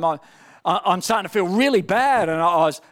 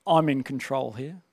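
lower, softer, quietly sure; calm spirit voice; 7 semitones lower, 5 dB quieter than usual. Retold or imitated. His spirit answering the hungry flesh, low calm authority. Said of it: "you know what my spirit was saying".

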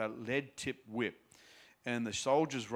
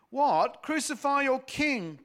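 high, loud disbelief; shocked; 12 semitones higher, 8 dB louder than usual. A narrator's reading. Pilate shocked at the crowd, loud and high. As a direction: recoil at the idea.

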